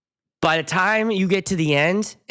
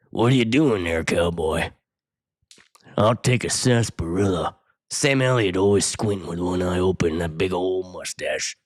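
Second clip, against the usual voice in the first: Sam Elliott impression; 7 semitones lower than usual. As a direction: growl it like a squinting old cowboy. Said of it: "That's pretty good, I think".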